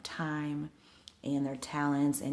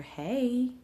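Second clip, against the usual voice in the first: high, drawn-out greeting call; April Franks Hunt's signature hey; 9 semitones higher, 4 dB louder than usual. Retold or imitated. Coach surprises her client at the brunch, high drawn-out hey. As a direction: sing out a big, raised hey. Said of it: "a hey that only April Franks Hunt can give you".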